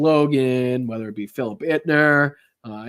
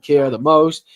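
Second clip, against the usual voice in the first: ordinary delivery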